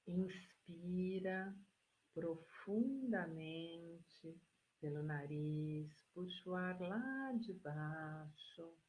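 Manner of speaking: very slow, soft, drawn-out instructions; guided breathing meditation. Real, not acted. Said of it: "Eu vou ter que mudar meu tom de voz". (meditação guiada, voz lenta e suave)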